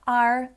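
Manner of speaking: "R" with the r clearly sounded; rhotic R. Shown as a demonstration. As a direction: say "R" as arr, not ah. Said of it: "So you've got that R there".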